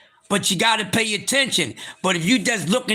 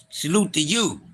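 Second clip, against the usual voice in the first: usual speaking voice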